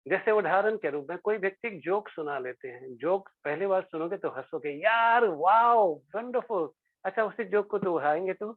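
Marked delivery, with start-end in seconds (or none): 4.7-6.7 delighted wow. Retold or imitated. First hearing of a joke.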